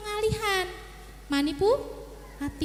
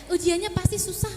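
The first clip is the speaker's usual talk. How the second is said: ordinary delivery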